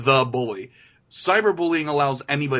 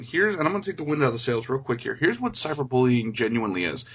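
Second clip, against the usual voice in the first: normal talking voice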